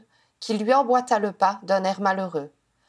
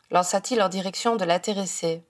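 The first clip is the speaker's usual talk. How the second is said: ordinary delivery, nothing marked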